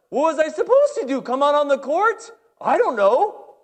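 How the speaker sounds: high, loud exasperated back-and-forth; exasperated argument. Retold or imitated. Coach and referee bickering, high loud exasperation. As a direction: throw up your hands in disbelief.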